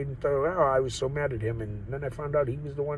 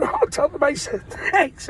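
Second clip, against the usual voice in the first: mock cheery reply; 14 semitones higher, 4 dB louder, slower than usual. Retelling drive-thru reply.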